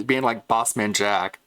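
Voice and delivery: ordinary talk, nothing marked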